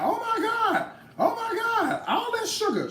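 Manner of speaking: high falsetto gasps, laughing at the end; mock shocked commenter. Cook mocking comments about sugar, high falsetto squeal. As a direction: parody the horrified comment section. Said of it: "I can see the comments right now".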